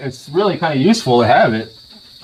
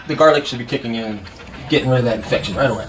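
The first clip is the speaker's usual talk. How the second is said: ordinary delivery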